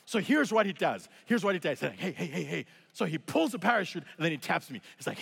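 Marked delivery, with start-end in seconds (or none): 2.0-2.7 soothing interjection. Retold or imitated. Instructor calming panicked jumper, retold.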